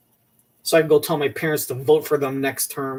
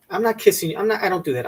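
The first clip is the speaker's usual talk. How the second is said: ordinary talk, nothing marked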